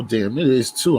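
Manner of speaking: normal talking voice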